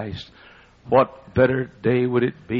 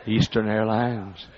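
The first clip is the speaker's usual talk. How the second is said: ordinary delivery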